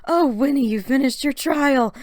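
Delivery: put-on high, loud, cheering girl voice; Mary cheering her sister's finished trial. A narrator's reading. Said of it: "Mary cheered".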